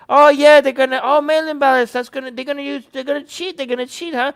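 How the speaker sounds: sly high mock Trump teasing. Trump hinting at fraud to fans, high sly mock. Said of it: "He's like".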